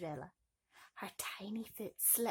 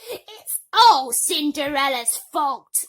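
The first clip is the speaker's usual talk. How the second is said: high, loud, slow stepsister whine; whining; 6 semitones higher, 17 dB louder, slower than usual. Acted. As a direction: whine it like a spoiled brat. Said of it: "whined Bertha".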